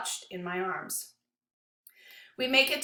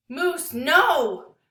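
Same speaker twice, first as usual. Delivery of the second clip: high, louder cry of alarm; desperate cry; 6 semitones higher, 8 dB louder than usual. A narrator's reading. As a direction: cry out in panic. Narrator crying out in alarm.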